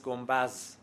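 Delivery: گنبز ending in z instead of d; colloquial z form. Shown as a demonstration. Linguist shows the everyday spoken form, گنبز with final z. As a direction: say گنبز as "gombaz". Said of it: "گفتاری و عامیانش با ز است".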